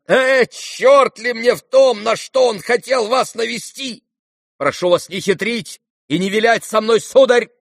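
high, loud, exasperated squeal; angry shriek. A narrator's reading. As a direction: cut in with an irate, high-pitched yell. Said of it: "взвизгнул Ганя". (раздражённый визг)

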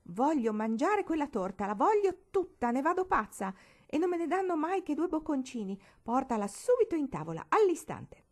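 loud, very high demanding shout; a spoiled child's rising tantrum. A narrator's reading. Barbara demands the forbidden cake, shouting tantrum.

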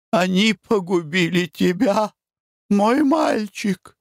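high, slow wailing; sobbing lament. A narrator's reading. Mother in hysterical weeping laments to her son, high. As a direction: wail over your son. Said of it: "говорила она".

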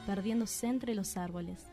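ordinary talk, nothing marked

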